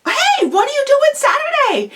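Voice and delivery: high-pitched, chirpy invite; eager casual invitation. Shown as a demonstration. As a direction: call out an upbeat invite to a friend. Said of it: "Another example".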